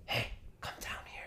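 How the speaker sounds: hushed, voiceless beckoning; eerie whisper. Retold or imitated. Unseen voice calling him down under the floor, creepy whisper. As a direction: whisper it like a lure from below. Voice from below answers.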